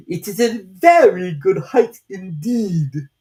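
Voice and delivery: slow, loud, offended retort; angry caterpillar. A narrator's reading. Caterpillar angrily defending its height to Alice, loud and slow. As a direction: bristle with offence. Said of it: "said the caterpillar angrily".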